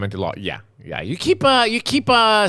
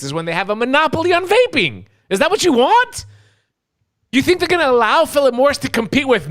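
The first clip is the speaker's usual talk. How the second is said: fired-up rant; 9 semitones higher than usual. Real, not acted. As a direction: let it boil over into a furious shout. Ranting at naive vaping advocates.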